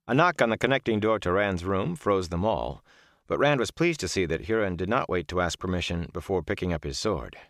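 plain reading voice

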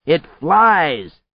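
high, loud short announcement; shouting. A narrator's reading. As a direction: call out FLIES over the wind. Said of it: "he shouted".

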